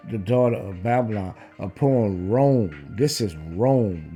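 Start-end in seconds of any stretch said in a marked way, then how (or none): none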